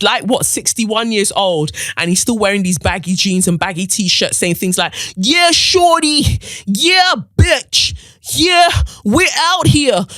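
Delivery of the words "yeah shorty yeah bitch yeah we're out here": high, loud, slow put-on street accent; mock his fake accent, drawn out; mocking Tim Westwood. Mocking an old DJ's fake accent. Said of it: "where did you get that accent".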